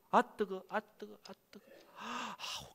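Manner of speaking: high, pained little yelps of "아, 뜨거"; mock cries of the damned. Preacher voicing a soul in hell, high pained yelps. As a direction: yelp like someone scorched in hell. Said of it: "지옥 가고 보니까 너무 뜨거운 거야".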